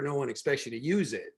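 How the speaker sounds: normal talking voice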